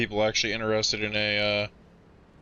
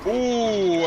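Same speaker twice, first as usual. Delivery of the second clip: surprised ooh; 10 semitones higher, 4 dB louder than usual. Real, not acted. Sees a crash.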